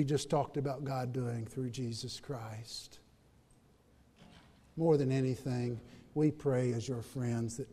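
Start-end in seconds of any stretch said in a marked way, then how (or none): 0.0-3.0 soft intimate whisper. Acted. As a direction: draw them in with a whisper. Intimate appeal, voice dropped.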